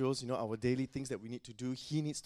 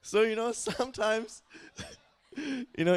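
high, loud laughter through the words; laughing; 10 semitones higher, 6 dB louder than usual. Real, not acted. Preacher still laughing at his own joke.

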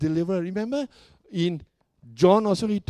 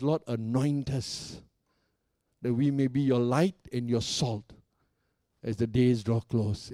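prayerful; 6 semitones lower than usual. Acted. Prayer quoted in sermon, slow and low.

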